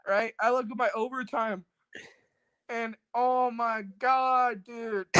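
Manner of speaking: normal talking voice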